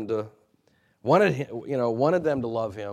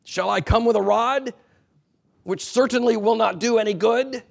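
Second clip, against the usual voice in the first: stern challenge; 9 semitones higher, 6 dB louder than usual. Retold or imitated. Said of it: "that last question".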